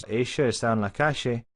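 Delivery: strong Argentine Spanish, calle said kashe; strong Argentine accent. Shown as a demonstration. Teacher contrasts strong Argentine accent with Latin American. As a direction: lean into the Argentine sh in Ella and calle. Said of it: "a very strong Argentine accent".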